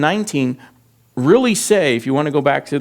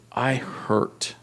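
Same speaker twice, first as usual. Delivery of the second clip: pained hush; 7 dB quieter than usual. Acted. Job's pain voiced.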